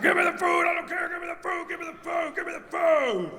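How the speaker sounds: high frantic begging; high demanding dog voice. Retold or imitated. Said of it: "And she's like".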